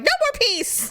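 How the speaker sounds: high-pitched comic outburst; mock triumph. Woman imagines the mantis dead, mock triumph. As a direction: declare it over in a high voice.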